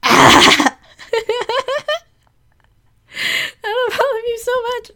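choked-up love, high and cracking; crying. Real, not acted. Speaker to her online friends, tearful and breaking. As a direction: voice breaking into tears while thanking friends.

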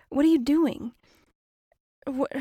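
normal talking voice

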